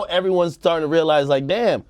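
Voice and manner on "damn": ordinary talk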